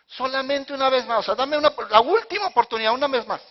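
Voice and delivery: high, loud mock begging; urgent plea. Samson limiting God to one chance, loud urgent pleading. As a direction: beg loudly for one last chance.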